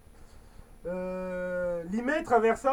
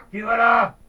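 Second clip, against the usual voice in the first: menacing lion; 9 dB louder than usual. Acted. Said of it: "rugit d'un air menaçant".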